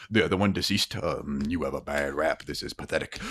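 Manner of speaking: detached alien, listing a death like data; alien entity voice. Acted.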